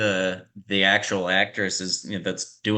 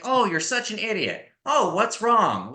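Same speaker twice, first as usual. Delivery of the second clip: high, bright, insult and concern in one breezy tune; sing-song, no shift between insult and comfort; same cheery tone; 11 semitones higher than usual. Retold or imitated. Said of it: "in the exact same inflection and tone".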